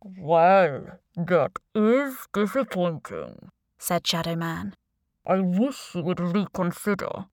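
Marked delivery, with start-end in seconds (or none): 0.0-3.5 slow, disguised villain voice; anonymous boss, drawn out; deep, distorted voice. A narrator's reading. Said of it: "distorted voice". 5.2-7.3 anonymous boss on a video call; deep, distorted voice. A narrator's reading. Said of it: "distorted voice".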